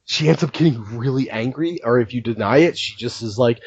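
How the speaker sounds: usual speaking voice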